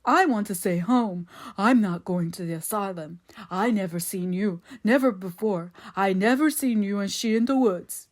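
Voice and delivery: put-on plaintive simpleton, childlike protest; near blubbering. A narrator's reading. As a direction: wail denials like a scared child. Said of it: "began to blubber like a child".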